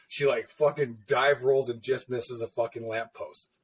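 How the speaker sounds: usual speaking voice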